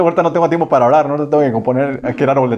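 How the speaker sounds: fast, brushing-off excuse; quick mock excuse; rushed excuse. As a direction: hurry past it like you're too busy. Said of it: "la respuesta a otros muchas veces es".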